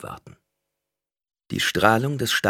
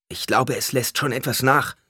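lightly voiced bystander, higher pitched; a raised, tentative-hopeful remark; someone in the crowd; 7 semitones higher than usual. A narrator's reading. One victim to the others as the danger seems to ease, raised voice. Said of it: "sagte jemand".

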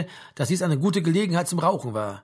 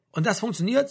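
higher, incredulous question; raised pitch, sceptical disbelief; disbelieving; 5 semitones higher than usual. A narrator's reading. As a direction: ask it as if you can't believe it. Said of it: "sagte Frank ungläubig".

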